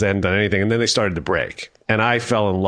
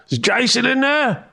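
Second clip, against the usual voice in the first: much higher, slow mock call down the hall. Band knocking on a door, calling for Jason. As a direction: call through the door for someone.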